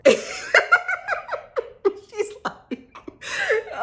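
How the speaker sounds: high, loud burst of real laughter; helpless cackling laugh. Real, not acted.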